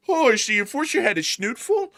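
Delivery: high prissy gossip; mock-scandalized busybody; prim gossip voice. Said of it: "someone's about to say".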